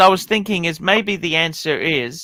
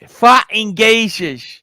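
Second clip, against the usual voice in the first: put-on Cockney accent; 4 semitones higher, 6 dB louder, slower than usual. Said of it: "your Cockney accent's pretty good".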